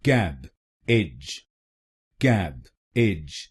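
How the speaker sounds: very slowly, कैब then एज; slow syllable split. Shown as a demonstration. Teacher slowly splitting the word for learners. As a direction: drag it out syllable by syllable. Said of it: "तो बनेगा".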